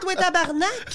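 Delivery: hammed-up Quebec accent, mocking; mock Quebecer taunt. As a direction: mimic an annoyed Quebecer online. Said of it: "les Québécois écrivaient".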